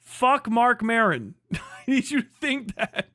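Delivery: normal talking voice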